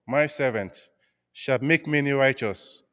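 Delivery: ordinary delivery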